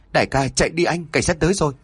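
high hurried warning; urgent. A narrator's reading. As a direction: rush the warning out. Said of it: "lớn tiếng giọng gấp gáp".

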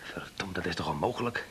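soft whispered curse; whispered disbelief. Acted. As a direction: hush it to yourself in disbelief. Alone, muttering in disbelief.

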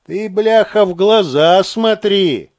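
usual speaking voice

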